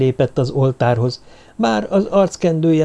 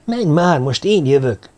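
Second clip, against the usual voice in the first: impatient prodding, starting higher; impatient urging; 3 semitones higher than usual. A narrator's reading. Druid wanting the next turn, impatiently urging. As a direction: push them along, mildly exasperated. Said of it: "türelmetlen noszogatását".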